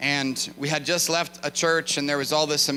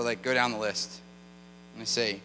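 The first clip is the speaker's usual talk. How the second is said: ordinary talk, nothing marked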